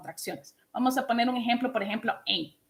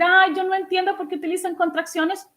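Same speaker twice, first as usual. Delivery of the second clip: mock negative whine; 7 semitones higher, 3 dB louder than usual. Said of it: "yo puedo ser negativa y decir".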